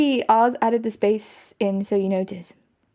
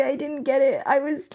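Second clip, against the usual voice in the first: usual speaking voice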